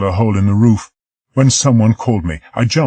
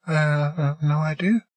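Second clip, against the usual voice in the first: higher, softer helpless answer; hopeless; 9 semitones higher, 5 dB quieter than usual. Acted. Margaret answering the doctor, softer and hopeless. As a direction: let it sink, no hope left. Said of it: "she said hopelessly".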